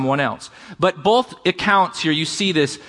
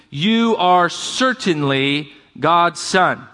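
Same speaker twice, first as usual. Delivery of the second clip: each word drawn out slowly; slow, weighty reading; much slower than usual. Shown as a demonstration. Pastor reading the disciples' confession, slow and solemn. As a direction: lean on every word of the confession.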